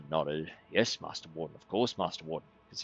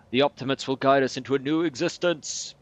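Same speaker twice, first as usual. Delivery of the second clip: preaching; 6 semitones higher, 6 dB louder than usual. Acted. Cultist preaching to brethren.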